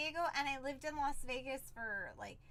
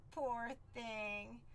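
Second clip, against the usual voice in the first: usual speaking voice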